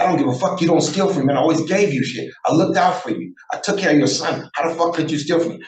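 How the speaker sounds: re-enacted indignant outburst; retold angry confrontation. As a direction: lay into a brother who betrayed you.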